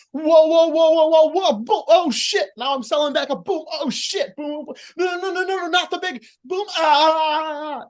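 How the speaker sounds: high hammed-up yelps of alarm; mock heel panic. Coach playing a heel selling the comeback, mock panic. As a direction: sell the hits in exaggerated high panic. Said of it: "or you can be".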